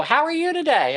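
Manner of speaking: bright, high, sing-song hello; upbeat store greeting; cheery greeting. Retold or imitated. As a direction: greet the clerk with big warmth. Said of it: "I go".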